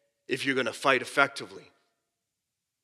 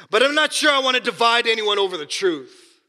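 dry, mock-innocent sarcasm, a bit louder; sarcastic; 6 semitones higher, 4 dB louder than usual. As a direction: say it tongue in cheek, pretending not to want division. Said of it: "this is what he said sarcastically".

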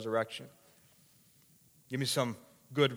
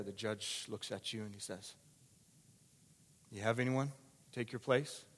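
much softer, low and hushed; hushed preaching; 3 semitones lower, 8 dB quieter than usual. Acted. Preacher voicing the judge's question, hushed and soft. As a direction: lean in and speak it gently. Preacher drops to near whisper.

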